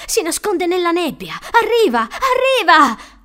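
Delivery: put-on high, loud panic of a hunted child; frantic shouted warning; terrified yelling. A narrator's reading. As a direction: cry out the alarm, building to a drawn-out scream. Terrified boy screams pursuer is coming.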